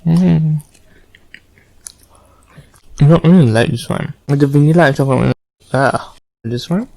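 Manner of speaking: talking with mouth full; words round a bite of cookie; chewing noisily. Real, not acted. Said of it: "Oh my god, I need to eat".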